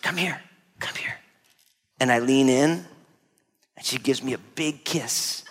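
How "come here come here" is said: hushed, very soft invitation; grandma's soft beckoning. Retold or imitated. Small grandma calling grandson closer, hushed beckoning. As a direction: beckon someone close, barely voiced. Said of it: "she whispers in my ear".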